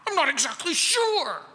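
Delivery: high, mock-upset confusion; worked up without knowing why; angry, flustered objector. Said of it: "they're so angry with you".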